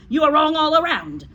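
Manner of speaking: high, loud berating; angry headmistress. Acted. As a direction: shout the pupil down. Trunchbull berates Eric.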